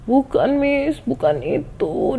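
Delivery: imitated tearful protest, starting high; menangis. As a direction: protest like a crying pupil, slowing down. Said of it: "Dia nangis tuh". (menirukan murid yang menangis)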